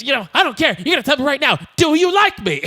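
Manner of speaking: mock-angry, high and rising pressure; imitating aggressive demand. Elliot pressing the silent girl, mock aggressive. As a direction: badger a child for an answer. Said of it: "like, really aggressive".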